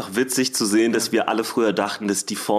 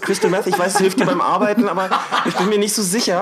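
higher, fussing pimp voice; caring pimp; 6 semitones higher than usual. Pretend. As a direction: play a doting pimp, half laughing. Role-plays caring pimp to Roxy.

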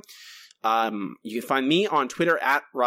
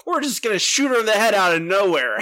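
high, louder smug mockery; mocking writer voice; 8 semitones higher, 5 dB louder than usual. [name] mocking the comic's writers with a high gloat. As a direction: gloat like a cruel writer, pitched up. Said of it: "mocking the writers".

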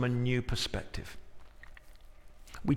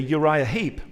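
ordinary delivery

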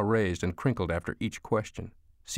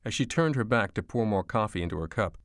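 ordinary delivery

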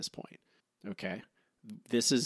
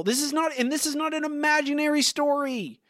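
exasperated rant; 14 semitones higher than usual. Real, not acted. Ranting about the episode's logic.

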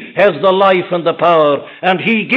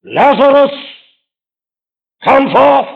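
loud call; 7 semitones higher, 5 dB louder than usual. Acted. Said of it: "It was in the voice".

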